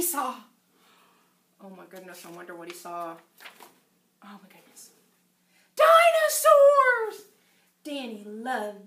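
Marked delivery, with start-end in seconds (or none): 1.6-4.9 soft, low, holding the breath before the reveal; hushed suspense. Acted. 5.7-7.3 drawn-out cry, much higher and louder; excited reveal. Acted. Big reveal in read-aloud.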